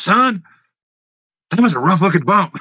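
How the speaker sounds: quoting Robert Gibson. Retold or imitated. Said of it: "He goes".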